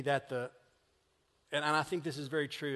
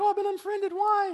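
much higher, whiny mock dismay; mock hurt whine; 7 dB louder than usual. Imitating a person upset at being unfriended, mock whining. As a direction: whine like someone wounded online. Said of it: "It's like".

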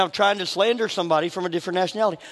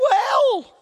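"Well" squeaked out high and loud; mock-stressed "Well" pitched way up; 13 semitones higher, 4 dB louder than usual. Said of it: "It didn't say".

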